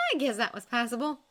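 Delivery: shrugging, dismissive "passable"; downplay it casually; "passable" said lightly, not impressed; offhand. A narrator's reading. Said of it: "as if to shrug".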